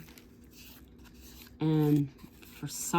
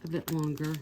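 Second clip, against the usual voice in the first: bluffing; 3 semitones higher than usual. Retold or imitated. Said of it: "B S'd and said".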